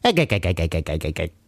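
rapid chuckling cartoon sailor laugh; staccato laugh that tumbles downward; Popeye. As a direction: chant it as a falling cartoon chuckle. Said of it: "What does Popeye have for breakfast?".

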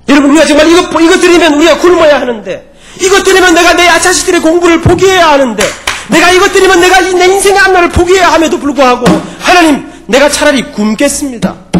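loud, high sustained preacher's cry; impassioned preaching. Acted. Preacher vowing to starve before God, impassioned high shout. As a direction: drive the sacrifice home at full force.

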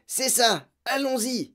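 high, keen rallying; eager agreement. A narrator's reading. Brother rallying the family, eager.

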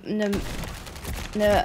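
normal talking voice

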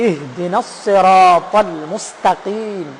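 slow, drawn-out Quranic recitation of ইহদিনাস সিরাতাল মুস্তাকিম; recitation demo. Shown as a demonstration. Teacher reciting a verse to show small ha, slow careful articulation. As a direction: stretch the long vowels of সিরাতাল and মুস্তাকিম, measured. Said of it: "যে হাটা".

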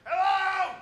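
high, shouted call for help; mock frustrated dad yelling for anyone; frustrated father calling. Comic retelling his father stuck in Hialeah, high frustrated yell. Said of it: "He's like".

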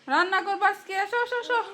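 high, lilting beckoning. Real, not acted. Mother calls toddler to come and cook, coaxing. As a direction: beckon a little one along sweetly.

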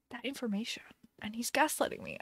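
hushed, voiceless whisper; conspiratorial whisper. Real, not acted. Streamer confiding a suspicion about boyfriend to viewers, conspiratorial whisper.

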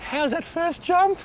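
high, drawn-out thrilled question; amazed. Real, not acted. Host marvels at the bass's jump to his mate, thrilled.

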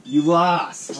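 a loud, high gloat at a friend; gloating taunt. Real, not acted. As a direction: rub it in, teasing. Teasing friend after the game.

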